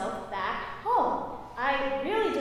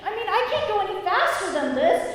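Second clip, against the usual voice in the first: higher, louder defensive protest; flustered protest; 6 semitones higher, 5 dB louder, faster than usual. Retold or imitated. Student protests to the yelling instructor, flustered and loud. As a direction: argue back in a panic. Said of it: "And I'm like".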